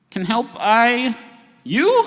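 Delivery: halting, high, slow alien-like speech; blank, eerie man. Acted. Gray mottled man greeting the crew, blank and halting. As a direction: feel out each word, pitch climbing oddly. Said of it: "with a blank expression and says".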